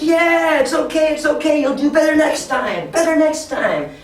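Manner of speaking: high, upbeat cheering for a loser; encouraging cheer. Pretend. Teacher cheering on kids who lose the games, high encouraging cheer. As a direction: keep their spirits up, pitched high. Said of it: "you can still cheer for them".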